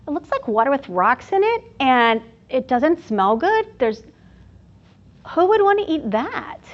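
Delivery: a hint of a skeptical kid, pitch jumping up at the end; child's voice. A village child doubting the healer's soup, lightly voiced child.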